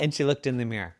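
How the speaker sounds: ordinary delivery, nothing marked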